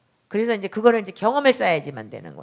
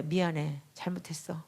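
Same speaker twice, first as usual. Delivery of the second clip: hushed apology; 4 semitones lower, 14 dB quieter than usual. Retold or imitated. Mother apologizing to daughter.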